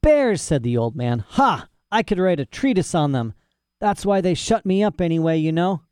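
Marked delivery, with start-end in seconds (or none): 0.0-0.4 high, loud burst; scornful exclamation. A narrator's reading. Old man seizes on the bears, excited. 1.3-5.8 put-on high-pitched, excitable old scholar; boastful mad professor. Imprisoned professor boasts of bear knowledge, excited.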